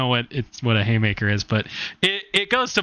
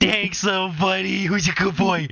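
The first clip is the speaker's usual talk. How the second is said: put-on higher-pitched good-boy baby talk; praising a pet; 4 semitones higher than usual. Game master voicing player thanking mini minotaur, cutesy praise. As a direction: praise it like a puppy.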